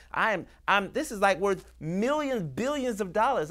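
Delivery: high, urgent, impassioned insistence; fired-up determination. Acted. Treasure hunter facing a once-in-a-lifetime find, fired-up determination. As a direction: get worked up over a priceless find.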